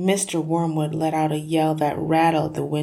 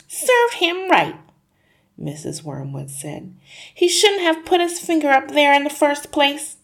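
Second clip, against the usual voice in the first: smug mother; 10 semitones higher, 5 dB louder than usual. Acted. Mother moralising to her family, prim and smug. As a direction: lecture like a prissy know-all.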